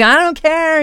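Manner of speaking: high-pitched, slow mock retort; exasperated coach. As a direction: dismiss it like a fed-up coach, acted.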